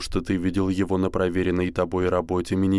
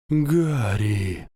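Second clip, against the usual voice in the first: weak dying man's whisper; a faint, failing plea from a dying man; faint deathbed whisper; 2 semitones higher than usual. A narrator's reading. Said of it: "прошептал умирающий голос профессора Квиррелла".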